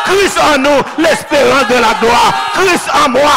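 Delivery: loud, rhythmic Haitian sermon cadence; fiery pulpit preaching building up; full-force preaching. Acted. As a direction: preach it out to the whole church. Preaching to the congregation.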